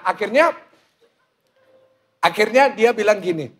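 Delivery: ordinary talk, nothing marked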